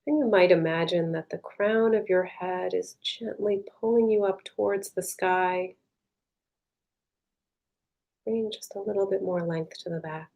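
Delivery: soft, unhurried meditation voice with long pauses; guided meditation. Real, not acted. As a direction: guide them gently inward, leaving space between phrases.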